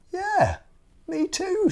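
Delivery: very high little bird voice; tiny high bird asking a favour; bird character voice. Acted. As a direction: ask sweetly, very high. Bird agrees.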